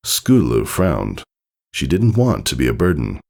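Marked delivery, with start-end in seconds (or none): none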